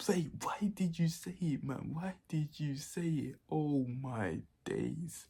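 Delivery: high, drawn-out mock wail; strained, hammed-up complaint at being betrayed; anguished protest. To the mate who named him, anguished high protest.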